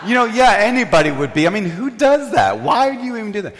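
high-pitched comic exasperation; indignant. Retold or imitated. Speaker justifies anger at friend to audience, indignant. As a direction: vent in hammed-up disbelief.

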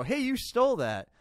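sharp, high, loud "gotcha" call-out; blunt accusation. Shown as a demonstration. Server bluntly accusing a diner of theft, loud and high. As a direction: bust the diner out loud, no tact. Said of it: "you don't go".

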